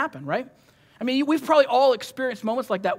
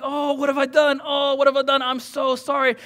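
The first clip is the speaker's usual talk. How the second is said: higher, louder mock panic; frantic retold wail of guilt; frantic apology; 5 semitones higher, 5 dB louder than usual. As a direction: act out a parent frantically apologising. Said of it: "And I'm frantic".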